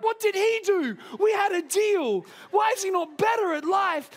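high, hammed-up outrage; mock indignant protest. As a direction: throw the blame back, comically high-pitched. Husband protests back in retold quarrel.

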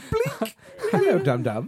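high, laughing cartoon alien voice; imitating the alien. Friend quotes the cartoon alien to his buddies, high and laughing. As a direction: laugh through a squeaky alien greeting. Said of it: "the whole bit of him just like".

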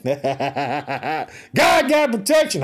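very slow, stretched-out shout; slow hollered preacher proclamation; drawn-out shout. Shown as a demonstration. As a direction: stretch every word and call it out. Repeats line drawn out, shouted.

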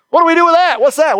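high, mock-baffled question; mock bewilderment; mock puzzled.

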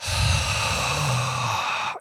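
long, forceful unvoiced exhale; demonstrated sigh. Shown as a demonstration. Worker showing his hurry-hours habit, long heavy exhale. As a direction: blow out a long heavy breath. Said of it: "I did this so many times".

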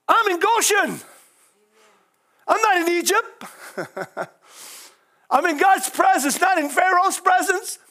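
fervent church preaching; preaching at full force. Acted. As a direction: lift it like a revival sermon. Preaching, raised voice.

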